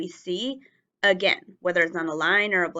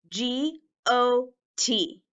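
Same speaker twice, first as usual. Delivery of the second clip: letters spelled out slowly; slow spelling; 4 semitones higher, much slower than usual. Shown as a demonstration. Teacher spelling the word slowly for the class. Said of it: "Repeat after me".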